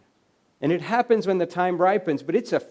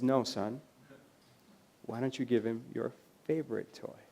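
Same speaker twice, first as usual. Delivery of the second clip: a hint of a stern father, much softer; quietly insist, like a patient dad; kind but stern father; 3 semitones lower, 11 dB quieter than usual. Father pressing his son to give his favorite toy, softly firm. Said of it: "Mufasa is kind, but also stern".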